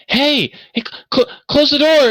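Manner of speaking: high, loud falsetto woman's shout; lady yelling. Acted. Lady in the background to man at door, shrill yelling. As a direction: stammer out an urgent, high-pitched order. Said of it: "you hear the lady in the background".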